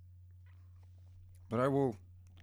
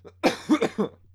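hard choking cough; loud coughing up water; coughing fit; 12 semitones higher, 12 dB louder than usual. Real, not acted. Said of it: "I can't even swallow my damn coffee".

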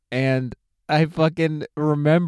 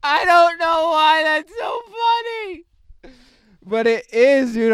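high, loud, helpless real laughter; crying with laughter; 5 dB louder than usual. Real, not acted. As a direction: laugh so hard the words barely come out. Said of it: "I'm crying".

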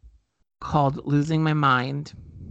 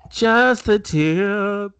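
slow suggestive drawl; 6 semitones higher, 5 dB louder, much slower than usual. Pretend. Echo drawn out.

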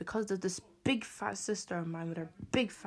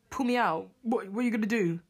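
angry retort; 3 semitones higher, 5 dB louder than usual. Retold or imitated. Retold shouting back at dad.

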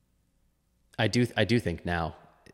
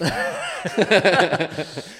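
big burst of laughter; loud laugh; 12 semitones higher, 11 dB louder than usual. Real, not acted. Laughs at own joke.

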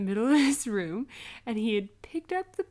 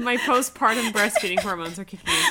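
laughing; 3 semitones higher, 7 dB louder than usual. Real, not acted. Laughing through emotional confession.

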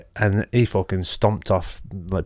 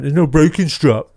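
mock know-it-all; 7 semitones higher, 4 dB louder than usual. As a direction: parrot him like a smug expert. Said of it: "he went".

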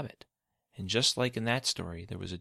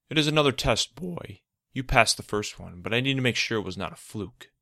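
loud, stern villain; 4 semitones higher, 7 dB louder than usual. Acted. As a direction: lay down the test coldly. Villain reveals the test.

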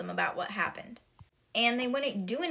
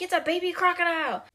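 high, loud, hurried mock alarm; retold yell; 8 semitones higher, 7 dB louder, faster than usual. Headmistress surprised by the newt, retold yell. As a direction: yell it out fast in exaggerated shock. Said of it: "So Trunchbull yells".

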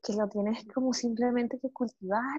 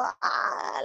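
breathy joyful ah; playful baby sound; imitating a playful baby squeal. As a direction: voice a baby who wants to play. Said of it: "quieren jugar todo el día como".

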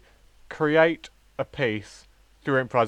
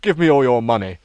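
loud, lightly acted stick-up demand; play-acted burglar, louder; burglar role; 7 dB louder than usual. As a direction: make a mock robbery demand. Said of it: "I'm gonna be a burglar".

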